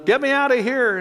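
high-pitched, demanding call of a resident; care home resident. Retold or imitated. Voicing agitated care home residents.

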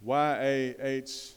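letters drawn out very slowly; spelled out slowly. Shown as a demonstration. Preacher spells the name for the congregation, very slow. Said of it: "they changed it to".

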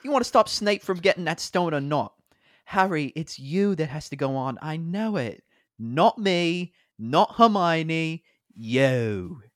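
high, loud, urgent British voice; British-accented Ron Weasley. Acted. Ron urging Harry to go on, forceful British accent. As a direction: insist dramatically in a British accent. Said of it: "I will be playing Ron Weasley".

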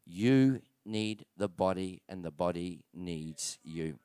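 a quiet, slow, pleading appeal; tender appeal. Real, not acted. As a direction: lean in and say it gently, slowly.

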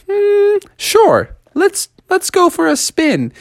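very high put-on girlish voice; high-pitched character voice; coy girl frog. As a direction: agree in a high, coy falsetto. Beatrice accepts the ride.

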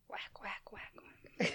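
unvoiced whack whack whack; whispered whacks. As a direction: whisper the hits. Dark joke sound effect.

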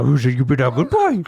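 praising a good dog; cooing at a pet. Acted. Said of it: "I even talk to myself in a dog voice".

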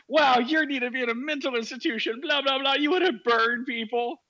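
high, loud caricature of an outraged woman; mock unhinged. Host mimics the atheist freaking out at his joke, mock unhinged. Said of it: "increasingly unhinged".

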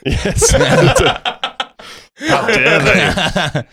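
mock outrage. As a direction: ham up the offence, very slow.

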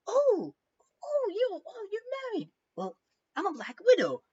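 very high, teasing spider voice; mock spider. Spider teasing Victor in the coffin, high and sly. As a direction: purr it like a sly little spider. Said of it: "this spider's like".

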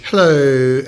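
a light put-on drawn-out hello; lightly voiced podcast host greeting; mock Tim Ferriss hello. Greeting the listeners like Tim Ferriss would. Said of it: "he goes".

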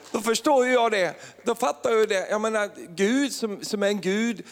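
high, emphatic realization; exultant preaching. Real, not acted. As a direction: proclaim it high with rising fervour. Exulting at God's answered prayer. (ivrigt jublande i högt tonläge)

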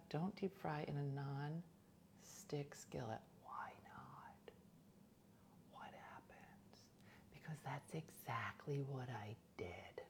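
soft secretive whisper. Real, not acted. Whispered warning and exchange.

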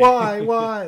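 high, loud mock wail; anguished cry. Friends at the empty hospital bed, loud mock wail. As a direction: wail it like he's lost. Friends fear Joey died.

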